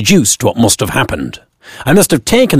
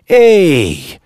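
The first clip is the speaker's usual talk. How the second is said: chuckling; 7 semitones higher than usual. Acted. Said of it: "chuckled the driver".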